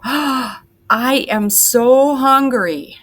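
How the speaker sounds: loud, high, drawn-out hungry exclamation; big excited teacher demo. Shown as a demonstration. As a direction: gush it like you can't wait to eat. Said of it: "excitedly would look at those pancakes and I would go".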